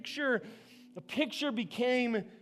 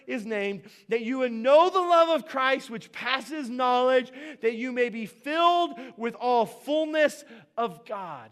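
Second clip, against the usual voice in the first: a measured, ringing Bible reading; scripture reading; 2 semitones higher, 4 dB louder than usual. Acted. Pastor reading Ephesians aloud to his church, ringing and measured. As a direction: proclaim the verses to the congregation.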